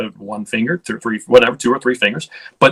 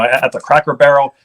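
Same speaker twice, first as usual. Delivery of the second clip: ordinary talk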